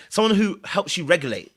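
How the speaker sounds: normal talking voice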